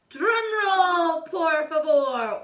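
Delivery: high loud command; grand announcement; ordered. Acted. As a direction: announce it like a ringmaster. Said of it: "ordered Don Diego".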